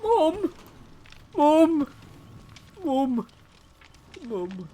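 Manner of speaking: high mock-plaintive calls, fading out; Quentin Smurf. Lost Quentin calling after mum, hammed-up and fading. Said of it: "I saved you from the accent".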